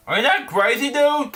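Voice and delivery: a rising, half-laughing are you crazy; incredulous aside. Real, not acted. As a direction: pitch climbing high in disbelief. Reader mocking a line in disbelief.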